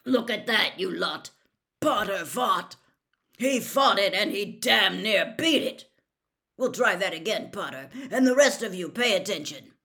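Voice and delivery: loud, gruff excited Moody; Moody praising Harry to the class, loud and gruff; booming praise. Acted. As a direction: boom it to the whole class.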